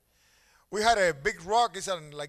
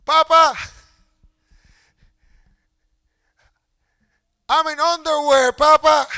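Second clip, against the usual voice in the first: put-on high, loud pleading child voice; shaking child; 9 semitones higher, 16 dB louder than usual. A father voicing his son, who begs for notice. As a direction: beg like a little boy shivering in the cold.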